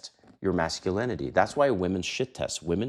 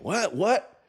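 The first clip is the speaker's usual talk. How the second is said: shocked what; 12 semitones higher, 3 dB louder than usual. Retold or imitated. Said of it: "she's gonna look at you like".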